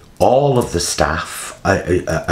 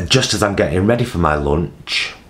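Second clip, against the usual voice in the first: ordinary talk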